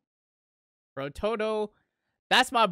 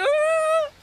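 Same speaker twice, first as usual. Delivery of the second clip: high, rising mock cry; imitated voice-actor scream; 3 dB louder than usual. Viewer imagining Mahito's actor in the studio, mock scream. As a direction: imitate an over-the-top wail, sliding up high. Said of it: "genuinely in the studio as he's voice acting".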